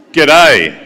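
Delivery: a loud Australian G'day, called back from the floor; Aussie greeting. Shown as a demonstration. Speaker asks the room for the greeting: loud Aussie G'day. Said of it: "What was that?".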